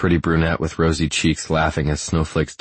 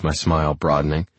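ordinary delivery, nothing marked

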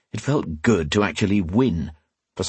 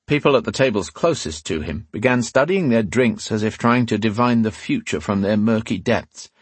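usual speaking voice

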